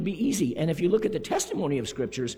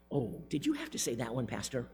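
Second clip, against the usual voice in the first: lightly imitated church complainer; mock grumbling, on the quieter side; grumbling churchgoer; 3 semitones lower, 5 dB quieter, faster than usual. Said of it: "no grumbling or complaining".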